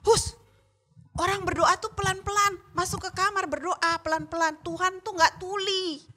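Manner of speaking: high hushing rebuke; scolding older sister. Retold or imitated. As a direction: tell the little one off. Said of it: "Dia ditegur sama kakaknya".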